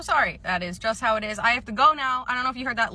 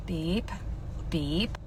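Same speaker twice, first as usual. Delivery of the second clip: short, soft, lower mouth beep like a barcode scanner; 4 semitones lower, 6 dB quieter than usual. Acted. Clerk scanning a customer's items, mouth-made scanner beep. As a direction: imitate the register scanning items.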